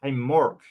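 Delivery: imitating Americans saying "Mork"; American mispronunciation. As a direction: greet with "Mork", vowel changed from "Mark". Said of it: "And they say".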